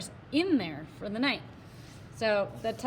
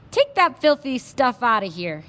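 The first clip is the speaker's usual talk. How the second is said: put-on louder, angry cowboy in a Texas accent; Texas shout; 3 semitones higher, 7 dB louder than usual. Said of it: "he suddenly shouted in his strong Texas accent".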